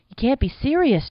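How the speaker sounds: high, loud, distressed objection; protesting. A narrator's reading. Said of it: "Jack protested".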